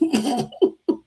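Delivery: a quick amused chuckle; short laugh. Real, not acted. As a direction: laugh at your own joke. Laughs at tomato line.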